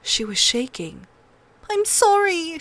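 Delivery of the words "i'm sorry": put-on high, sobbing girl's apology; tearful little girl. A narrator's reading. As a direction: sob it out like a scared child. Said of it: "she sobbed".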